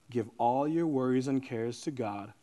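low, soft reading of the verse; gentle scripture delivery. Real, not acted. Preacher offers worried listeners a verse, quietly.

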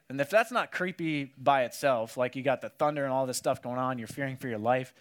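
ordinary talk, nothing marked

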